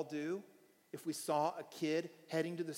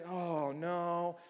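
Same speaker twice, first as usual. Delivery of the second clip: usual speaking voice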